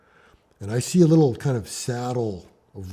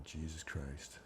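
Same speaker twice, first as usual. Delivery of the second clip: hushed inner thought; 5 semitones lower, 17 dB quieter than usual. Retold or imitated. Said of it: "I'm going".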